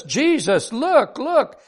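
high, eager mock scream; excited child screaming. A narrator's reading. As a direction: act out a thrilled little girl calling out. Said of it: "screaming her name".

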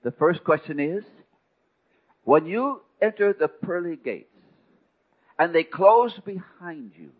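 a hint of a booming heavenly voice; voice of the Lord. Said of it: "And the voice said".

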